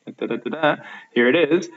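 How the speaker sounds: ordinary delivery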